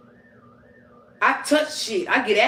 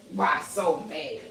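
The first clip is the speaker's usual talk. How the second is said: mock taunt; 5 semitones lower, 6 dB quieter, slower than usual.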